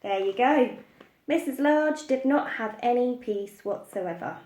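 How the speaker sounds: ordinary delivery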